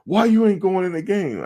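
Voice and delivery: high-pitched irate coach yell; mock coach screaming. Coach demanding why his player isn't playing, high mock scream. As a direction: yell it like a coach about to blow. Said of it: "I thought he was gonna have a stroke".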